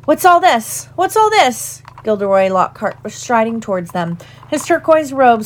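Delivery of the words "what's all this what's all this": high, hearty busybody breaking in; high-pitched showy teacher voice; pompous interruption. A narrator's reading. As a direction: call out cheerily as you stride up. Lockhart breaks in on the crowd.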